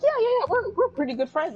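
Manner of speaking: high eager imitation; imitating Mikotoba. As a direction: chirp it like an eager friend. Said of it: "And then Mikotoba's like".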